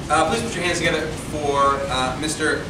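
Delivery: ordinary delivery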